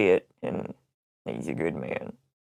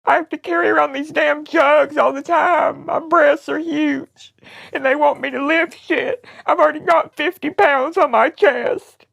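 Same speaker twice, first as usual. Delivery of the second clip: mock-tearful griping. Prank caller griping about boxes and jugs, crying. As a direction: pile up complaints through fake tears.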